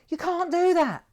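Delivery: loud, high-pitched reprimand; outraged retold protest. Young worker grabbing the foreman's stick, outraged protest. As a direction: re-enact the outburst, high and forceful.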